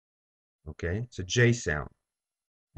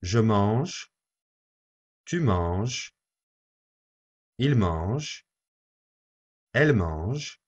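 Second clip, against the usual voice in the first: repeat after me; slower than usual. Shown as a demonstration. Teacher leading the class through a repeat-after-me drill. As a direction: clear drill read with a pause after each form. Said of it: "Please repeat after me".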